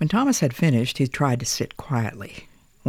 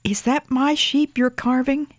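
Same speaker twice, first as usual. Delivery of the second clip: put-on child voice; 12 semitones higher than usual. A narrator's reading. Young boy voiced in story.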